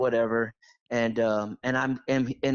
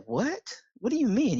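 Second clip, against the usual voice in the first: surprised; 9 semitones higher than usual. Retold or imitated. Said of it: "I was like".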